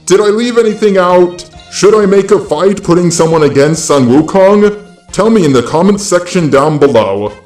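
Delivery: ordinary talk, nothing marked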